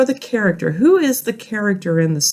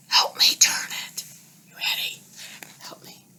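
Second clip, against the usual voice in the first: unvoiced soft whisper to the class; whispered aside; 8 dB quieter than usual. Real, not acted. Teacher asking the children quietly to help turn. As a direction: whisper a secret request. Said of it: "whispered aside to class".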